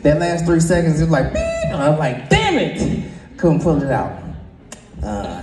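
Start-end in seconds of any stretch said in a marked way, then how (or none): 2.3-3.0 high outburst. Retold or imitated. Frustrated outburst retold.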